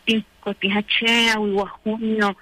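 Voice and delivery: ordinary talk, nothing marked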